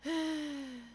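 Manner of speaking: high, drawn-out deflated sigh; long sigh. Real, not acted. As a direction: let out a long breath. After the failed encounter story.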